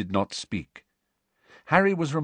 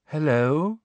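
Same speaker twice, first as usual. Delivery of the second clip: vague, dreamy; 6 semitones higher than usual. A narrator's reading. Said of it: "said a vague and dreamy voice".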